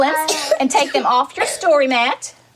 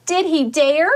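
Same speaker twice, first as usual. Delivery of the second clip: ordinary talk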